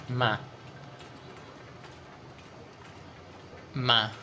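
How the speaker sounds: MY shortened to "ma"; dialect MY. Shown as a demonstration. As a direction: say MY as a short, open "ma". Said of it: "what do I say instead of my".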